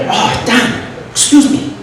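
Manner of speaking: high, rough Vietnamese accent impression; hammy Vietnamese accent; imitated Vietnamese accent. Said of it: "have to excuse my accent".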